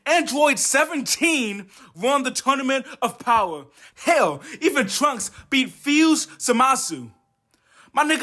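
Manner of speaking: ordinary delivery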